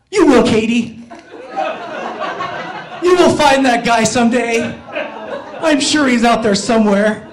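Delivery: acted brave face, over-supportive; forced encouragement. Rejected suitor consoling the girl he likes, forced encouragement. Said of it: "what I actually did was say out loud".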